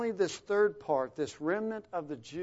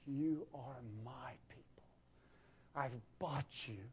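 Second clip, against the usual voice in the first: whispered, soft and loving; tender whisper; 3 semitones lower, 11 dB quieter than usual. Pretend. Father to his people, whispered tenderly. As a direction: breathe it out like a father's secret. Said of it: "when he says of his people".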